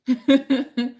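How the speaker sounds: loud, high chuckling burst; real, loud laugh; amused laugh. Real, not acted. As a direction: laugh out loud after clowning. Laughs after singing.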